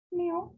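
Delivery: high rising meow; a coaxing little meow to call the cat; meowing up at the cat to coax it over; imitated meow.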